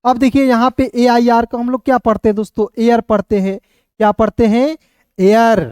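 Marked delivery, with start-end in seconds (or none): none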